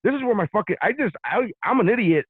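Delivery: high, loud fired-up Miami bravado; ready to square up, voice cranked up high; Miami attitude. Retold or imitated. Traveler firing back in his head at the agent, high and loud. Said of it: "I felt like I was back in Miami".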